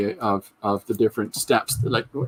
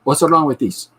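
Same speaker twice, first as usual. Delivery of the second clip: louder, higher cliché Italian father calling out; hollering for Salvatore like a stock Italian papa, Italian accent; Italian dad accent; 5 semitones higher, 7 dB louder than usual. Acted. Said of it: "based on my friend Sal's dad".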